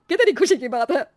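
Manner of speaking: loud, high burst of joy; delighted mother. Acted. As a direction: exclaim it like a thrilled mother. Mother reacts to good news. (खुशी से चहकती माँ)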